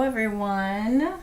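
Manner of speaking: ordinary talk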